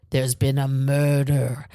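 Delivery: slow, drawn-out mock murder announcement; theatrical announcement. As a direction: imitate a stage player solemnly drawing out the line. Acts out murder mystery's opening announcement.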